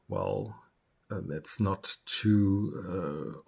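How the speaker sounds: ordinary talk, nothing marked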